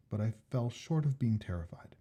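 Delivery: ordinary delivery, nothing marked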